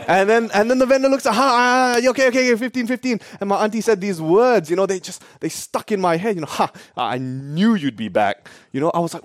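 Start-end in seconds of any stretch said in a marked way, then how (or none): 1.3-3.2 high, repeated mock surrender; high-pitched hurried agreement; flustered giving-in. Vendor gives in to the price. 6.5-8.5 low, slow, knowing gloat; imitated cool self-assurance; smug confidence. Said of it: "Such confidence".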